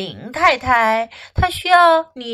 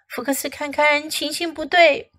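ordinary delivery

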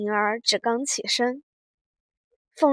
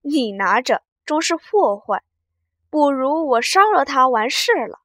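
sly laugh; 3 semitones higher, 7 dB louder than usual. A narrator's reading. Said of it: "笑道".

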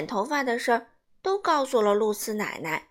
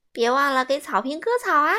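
loud mocking jab; jeering kid; 4 semitones higher, 8 dB louder than usual. A narrator's reading. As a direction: tease him loudly about the lawn. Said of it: "尼可说". (尼可大声嘲笑西蒙)

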